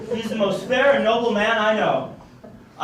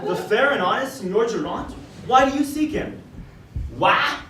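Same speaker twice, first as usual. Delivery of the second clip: ordinary delivery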